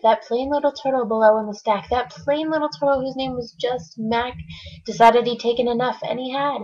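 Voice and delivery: ordinary delivery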